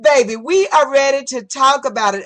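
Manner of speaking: ordinary talk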